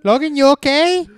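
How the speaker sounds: high, loud questioning mom voice; ex's mom checking. Retold or imitated. Ex's mom asks frozen man if he's okay, high mock concern. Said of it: "she's like".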